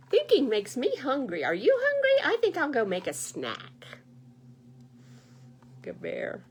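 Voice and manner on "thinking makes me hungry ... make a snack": loud goose, leaping very high on are you hungry; bubbly and pushy, squeaking the question; chatty goose. Acted. Goose offering bear a snack, loud chirpy chatter.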